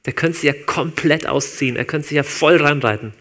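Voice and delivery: normal talking voice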